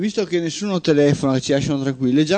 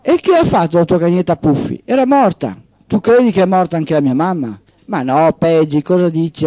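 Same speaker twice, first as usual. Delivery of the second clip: steady radio storytelling voice; host reading a short story on air; story narration; 2 semitones higher, 5 dB louder than usual. Acted. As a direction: read it like a tale to the listeners. Host reads a story aloud.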